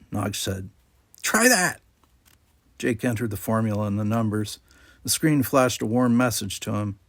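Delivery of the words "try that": put-on high, quick young Ferengi yes; eager Nog. A narrator's reading. Nog confirms Jake's answer, a high eager Ferengi kid voice. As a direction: pipe up sharply.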